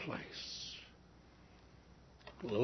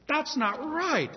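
"That's not right" high and slow, outraged; indignant; 9 semitones higher, slower than usual. Acted. Poor man protesting the theft, high and indignant.

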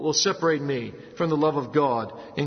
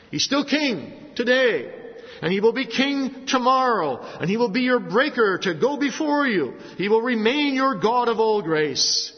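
louder, higher, slower pulpit proclamation; preaching at full force; 4 semitones higher, 4 dB louder than usual. Acted. Preacher proclaims the lasting kingship of Christ, slow and loud. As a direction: drive the promise home from the pulpit.

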